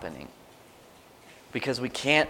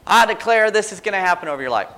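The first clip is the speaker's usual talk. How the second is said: higher, louder, fast imitated proclamation; mock declaration; 8 semitones higher, 6 dB louder, faster than usual. Someone declaring over a person's life, mock preacher. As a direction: ham up an empty prophecy. Mocking an empty prophetic declaration.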